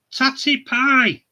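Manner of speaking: drawn-out, loud "Tatty pie" falling at the end; lingering hammed-up farewell; slow catchphrase. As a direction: savour it slowly as a final goodbye. Sign-off catchphrase repeated.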